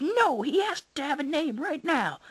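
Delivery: put-on high, loud demanding kids; shouting. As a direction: shout it like insistent boys. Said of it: "The boys shouted".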